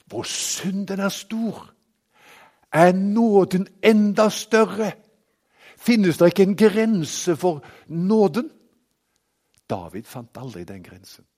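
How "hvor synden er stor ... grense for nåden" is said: raised, louder pulpit voice; ringing sermon delivery; full-force preaching. Acted. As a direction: proclaim it to the whole congregation. Preaching climax on grace.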